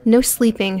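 plain reading voice

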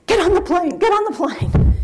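high, loud, urgent near-scream; almost screaming. Retold or imitated. Boss on the phone ordering her to fly now, near-scream. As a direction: yell it like the story just broke. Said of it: "almost screaming".

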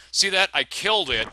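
loud, high-pitched outrage; indignant protest. Real, not acted. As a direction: protest the cheating game loudly. Protesting an unfair game moment.

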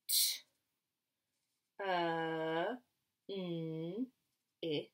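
"T, uh, n, ip" as separate sounds; sounded out. Shown as a demonstration. Teacher segments a word for children, sounded out "T, uh, n, ip". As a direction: say "T, uh, n, ip" sound by sound, with gaps. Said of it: "only makes one sound".

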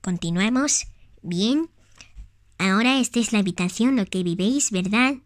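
low, soft mom voice; mother toy voice. Pretend. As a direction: play the gentle mother of Tag. Plays Tag's mother.

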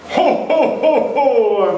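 high jolly Santa laugh. Acted. Trying on the Santa suit.

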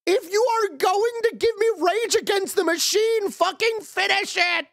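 exasperated yell. Real, not acted. Yelling at the game's music.

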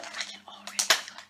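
fading whisper of dread; fainter whisper. Real, not acted.

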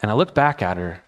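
ordinary delivery

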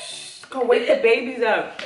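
laughing. Real, not acted. Laughing fit.

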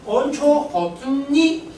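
호톤妮 with the added n sound before 妮; pronunciation demo. Shown as a demonstration. Teacher modelling 온초 호톤妮 for Manchu learners. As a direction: say 온초 호톤妮, linking the N into 妮. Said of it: "要读成妮".

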